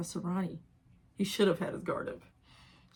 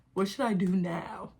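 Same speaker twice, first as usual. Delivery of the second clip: normal talking voice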